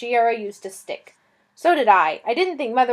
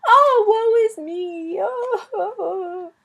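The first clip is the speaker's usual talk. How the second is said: much higher, loud, slow wailing lament; tearful lament; 12 semitones higher, 10 dB louder, slower than usual. Acted. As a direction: wail over the fallen knight like a tragic heroine. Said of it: "she burst into tears".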